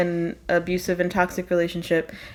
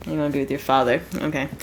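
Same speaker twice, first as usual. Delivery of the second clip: ordinary talk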